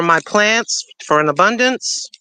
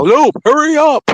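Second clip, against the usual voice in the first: impatient nag; 7 semitones higher, 4 dB louder than usual. Retold or imitated. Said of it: "I'm not like, you know".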